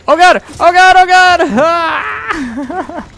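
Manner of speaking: high loud panicked scream; panicked yelling. Real, not acted.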